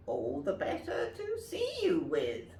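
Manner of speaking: smooth cunning wolf; wolf in granny's bed answering; sly wolf. Acted. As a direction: purr the answer slyly. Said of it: "said the wolf".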